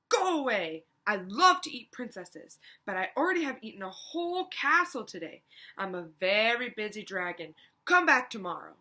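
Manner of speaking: a big busy dragon booming out his boasts; boastful dragon. Acted. Dragon speaks from his door.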